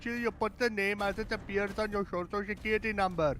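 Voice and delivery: lightly put-on Indian accent; a second player joins in with an Indian accent; another Indian accent. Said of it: "another Indian accent".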